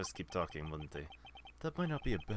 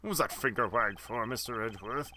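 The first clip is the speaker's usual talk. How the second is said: ordinary delivery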